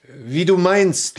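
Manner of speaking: curt retort. Acted. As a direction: fire it back, slowly. Sharp reply to his wife's orders.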